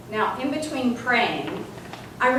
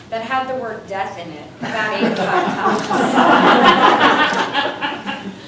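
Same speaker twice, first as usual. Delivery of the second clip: slow, louder, laughing through it; 3 semitones higher, 9 dB louder, much slower than usual. Real, not acted. Laughing about the death waiver. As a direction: drag it out, laughing at the absurdity.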